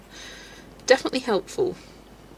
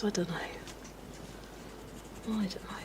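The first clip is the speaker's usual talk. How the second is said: very soft muttering to herself; distracted soft mutter; 18 dB quieter, faster than usual. Real, not acted. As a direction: trail off under your breath.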